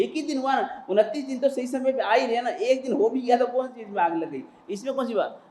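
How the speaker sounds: cheeky dismissive disciple. Acted. Disciple brushes off guru's scolding.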